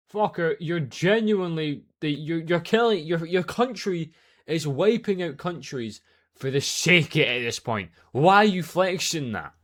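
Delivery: loud, slow, exasperated yelling; angry rant. Real, not acted. Ranting at American commenters, loud and furious. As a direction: shout down the commenters in disbelief.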